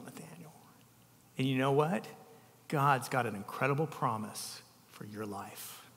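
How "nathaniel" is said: soft, breathy whisper of the name. Acted. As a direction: whisper it tenderly, like a secret. Whispered name after blessing.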